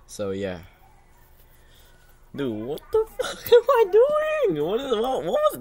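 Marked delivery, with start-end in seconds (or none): 2.3-5.6 high, laughing shriek of disbelief; cracking up in horror at the clip. Real, not acted. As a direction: squeal through laughter at yourself. Cringing at own old clip.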